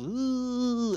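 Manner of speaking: high, loud "ooh" before the headbutt; a sharp squeal from the old man; old man's grunt. Retold or imitated. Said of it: "the old man goes".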